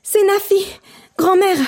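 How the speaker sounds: put-on high, out of breath girl; breathless. A narrator's reading. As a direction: gasp it out after running. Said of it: "dit-elle tout essoufflée". (essoufflée)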